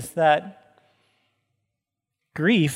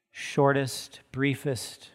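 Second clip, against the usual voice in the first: ordinary talk, nothing marked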